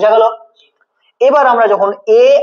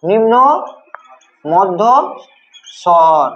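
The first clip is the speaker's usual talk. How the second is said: নিম্ন, মধ্য, স্বর with long pauses between; slow dictation; much slower than usual. Shown as a demonstration. Teacher dictates term word by word. (নিম্ন মধ্য স্বর থেমে থেমে)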